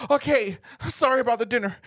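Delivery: high imitated girlfriend voice; flustered apology. Girlfriend scolded over dinner, high flustered apology.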